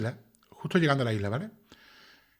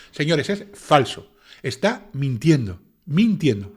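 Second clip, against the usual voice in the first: slow, louder, every word hammered; emphatic denial; 4 dB louder, much slower than usual. Real, not acted. Commentator to viewers, slow hammered denial. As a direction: lean hard on falso and mintiendo. Denouncing the defense's claim.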